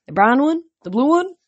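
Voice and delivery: high, puzzled musing; high questioning. A narrator's reading. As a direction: wonder aloud up high. Narrator wondering which eye is fake.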